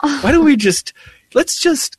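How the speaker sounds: hammed-up high imitation of a gentle guide; imitating the tour guide. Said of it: "He goes".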